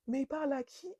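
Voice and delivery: softly baffled, as if to oneself; stunned disbelief. Retold or imitated. Her stunned aside as he rants, hushed incredulity.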